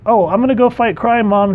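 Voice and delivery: put-on high, breezy teen announcing to mom; a carefree kid skipping out; lightly voiced eager teenager; mock cheery kid. Speedball telling his mom.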